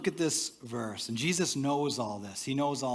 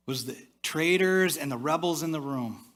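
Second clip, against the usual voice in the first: usual speaking voice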